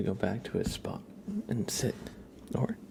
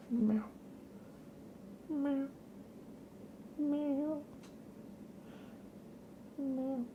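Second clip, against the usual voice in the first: high mock meow at the cat; 8 dB quieter, much slower than usual. Streamer meowing at her cat, high soft meow.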